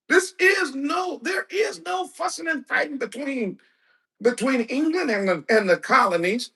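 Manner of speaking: preacher's fired-up shout; emphatic shout. Real, not acted. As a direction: shout it down from the pulpit. Preacher hammering a point home.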